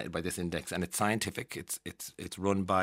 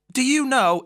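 high, loud imitated stranger; hammed-up keen enquiry; mock eager question; 8 dB louder, slower than usual. Stranger quizzing an Irish person abroad, mock eagerness. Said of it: "people will ask when they hear".

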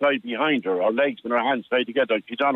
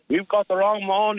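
put-on higher-pitched Monaghan accent repeat; 7 semitones higher than usual. Victim retelling the raider's complaint, in a Monaghan accent. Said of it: "He gets this kind of a Monaghan accent".

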